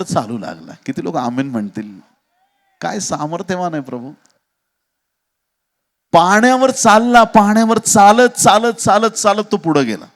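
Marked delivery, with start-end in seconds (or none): 6.1-10.1 loud, high chant-like repetition; rousing preaching. Real, not acted. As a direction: preach it loud and high, driving each repeated word onward. Preacher dramatizes walking on water.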